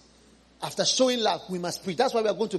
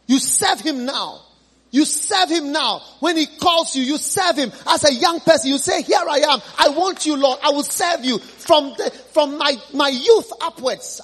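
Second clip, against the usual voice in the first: full-force preaching; 7 semitones higher, 7 dB louder than usual. Real, not acted. Preacher urging young people, loud and high. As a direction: shout the call to serve. Preacher urges youth to serve.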